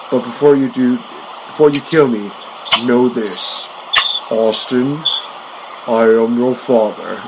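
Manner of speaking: slow, lightly voiced Doctor Evil, dragging out the line; a hint of the villain revealing he is Austin's father, drawn out slow. To Austin, mock villain reveal in a light Doctor Evil voice. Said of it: "Doctor Evil just says".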